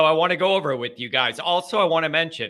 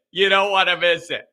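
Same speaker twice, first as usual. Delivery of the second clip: laughing. Real, not acted. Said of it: "repeat with laugh".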